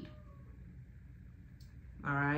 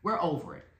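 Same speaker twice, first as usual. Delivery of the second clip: lightly voiced ancestors, lower and softer; a quiet, low dismissal, mildly acted; fed-up ancestors; 6 semitones lower, 7 dB quieter than usual. Ancestors scolding her on the park bench, mildly fed up. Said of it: "And they were like".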